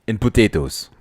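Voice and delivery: hammed-up Russian accent. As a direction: try a cartoonish Russian accent. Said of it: "My, at a Russian accent?".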